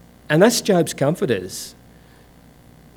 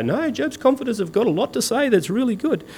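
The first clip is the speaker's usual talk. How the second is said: higher, softer mock approval; mock commentator; 7 semitones higher, 5 dB quieter than usual. Preacher mimicking readers who defend the comforters, higher soft mock praise. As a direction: lightly parody an admiring reader. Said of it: "They go".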